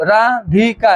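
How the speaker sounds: "ৰা, ধি, কা" as three separate beats; split into syllables. Shown as a demonstration. Teacher shows class how a name divides, "ৰা, ধি, কা" split into syllables. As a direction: pause between each syllable of "ৰা, ধি, কা". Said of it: "এনেকৈ যে ভাগ কৰিছো".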